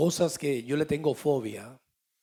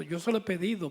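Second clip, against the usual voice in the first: ordinary talk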